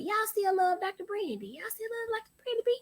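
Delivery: small, very high pleading voice; mock tearful. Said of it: "I'ma start crying".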